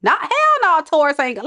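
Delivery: high, loud sassy call-out; scolding the cross watcher, high and loud. Real, not acted. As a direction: tell the watcher off with attitude. Reader scolding the person.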